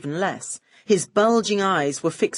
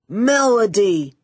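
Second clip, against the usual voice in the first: enthused; 3 semitones higher, 5 dB louder, slower than usual. Acted. Max greeting Melody, slow loud besotted cry. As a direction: call her name like a besotted fool. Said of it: "he enthused".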